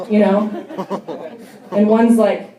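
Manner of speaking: ordinary talk, nothing marked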